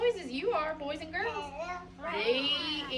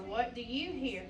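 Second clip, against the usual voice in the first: ordinary talk, nothing marked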